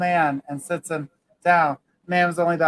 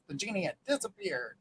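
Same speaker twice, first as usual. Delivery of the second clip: normal talking voice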